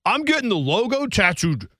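high, hammed-up bar boast; mock the younger self making a big vow; boastful drunk promise. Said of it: "I told people at a bar once".

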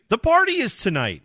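high excited squirrel; high, bright storybook critter. Acted. Reading squirrel dialogue.

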